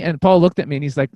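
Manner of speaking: ordinary talk, nothing marked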